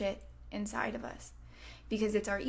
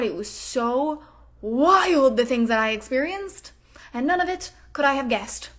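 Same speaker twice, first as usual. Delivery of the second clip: louder, gushing rundown of a wild life; excited life recap; 6 dB louder than usual. Retold or imitated. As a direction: tell the angels about a wild life, thrilled. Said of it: "you're like".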